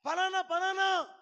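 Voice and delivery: high, loud shout to be heard. Retold or imitated. Mother shouting after her son in the street, loud and high. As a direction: yell it out so he turns around. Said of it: "గట్టిగా అరిచి చెప్పిన".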